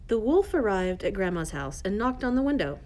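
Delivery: plain reading voice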